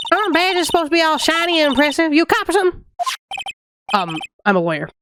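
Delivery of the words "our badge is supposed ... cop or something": lightly voiced Southern lady, offended; Southern accent. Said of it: "give her the southern accent".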